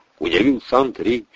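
ordinary delivery, nothing marked

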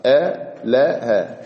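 آلها broken into "a, la, ha", very slowly; آلها syllable by syllable, with gaps between; three open syllables. Shown as a demonstration. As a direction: give each letter of آلها its own open a. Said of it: "ثلاثة الأحرف".

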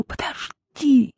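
high, slow, urgent plea; sudden urgent cry. A narrator's reading. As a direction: call her back with sudden force. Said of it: "С неожиданным напором проговорила мать".

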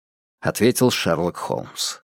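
ordinary delivery

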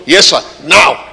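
high, loud insistent order; insistent shout. Retold or imitated. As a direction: bark it out, right now. God insists.

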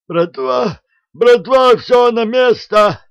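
panicked high, loud call to the gang; terrified wheezing cry. Acted. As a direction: squeal for the crew in terror. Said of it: "просипел ... посиневший от страха атаман".

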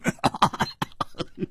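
breathy, staccato triumphant laughter; long ha-ha of gloating; big laugh. A narrator's reading. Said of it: "胡铁花大笑".

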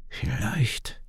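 soft, breathy murmur, half whispered; thinking aloud, barely audible; quiet murmur under his breath; murmuring to himself. A narrator's reading. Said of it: "murmelt Kian leise vor sich hin".

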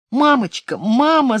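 put-on high, loud child's wail. A narrator's reading. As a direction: cry out for mommy. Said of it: "заревела она".

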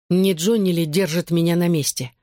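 ordinary delivery, nothing marked

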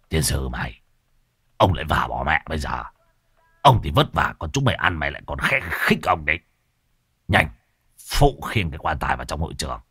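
put-on low, grumbling old man cursing; old undertaker scolding the loafer, low and gruff. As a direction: growl it down low like a cranky elder. Old Muôn Phọt voiced.

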